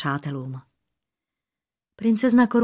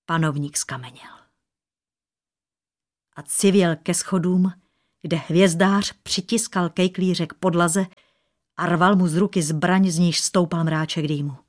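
ordinary delivery

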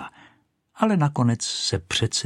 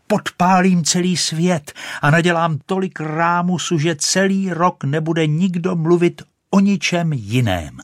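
loud, high, sustained boast; shouting; 8 semitones higher, 5 dB louder than usual. A narrator's reading. Rocket threatens to set the world alight, shouting. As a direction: keep it pitched up and forceful throughout. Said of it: "křičela".

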